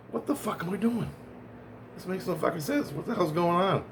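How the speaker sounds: hushed, high-pitched mock bewilderment; retold bafflement. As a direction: act out asking yourself what on earth is happening. Acts out own baffled reaction.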